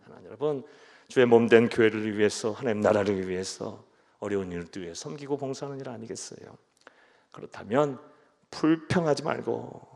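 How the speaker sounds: ordinary talk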